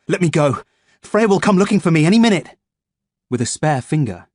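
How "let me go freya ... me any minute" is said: louder, higher, rising protest; frightened defiance; desperate plea. A narrator's reading. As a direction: push back in panic. Captive pleads and threatens.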